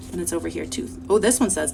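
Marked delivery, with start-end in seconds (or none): none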